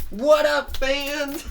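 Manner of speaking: bouncy cartoon hero voice; cheery hero greeting. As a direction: greet the crowd like a star. Fango greets his fans.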